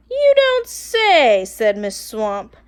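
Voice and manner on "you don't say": high, slow, loud mock surprise; sarcastic. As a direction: fake innocent surprise. Disguised teacher feigns innocence.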